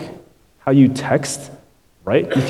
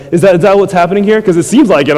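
fast, louder and higher, half-laughing disbelief; pressing the congregation, higher and louder; incredulous push; 6 semitones higher, 6 dB louder, faster than usual. Real, not acted. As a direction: fire the question at them. Preacher presses incredulous question.

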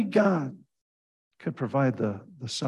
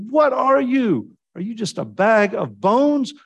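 high, loud, searching challenge; challenging questions; 9 semitones higher, 4 dB louder than usual. Retold or imitated. Preacher retelling how he challenged the students, intense. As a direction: corner them with the question. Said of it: "I said".

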